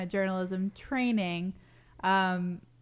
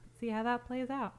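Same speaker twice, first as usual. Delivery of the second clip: hushed, higher sports commentator; lightly voiced golf commentator; 4 semitones higher, 4 dB quieter than usual. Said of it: "picks up the commentator voice".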